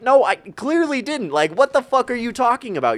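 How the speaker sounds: high-pitched, retold protest; indignant denial. Retells indignant denial to accuser.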